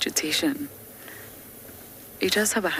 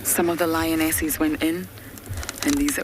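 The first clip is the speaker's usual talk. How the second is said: usual speaking voice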